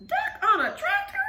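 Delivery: high astonished grandma; amazed. Acted. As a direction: gasp it in disbelief. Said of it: "Grandma gasped".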